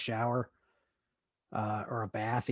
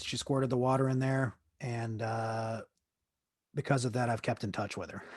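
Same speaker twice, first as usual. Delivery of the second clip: normal talking voice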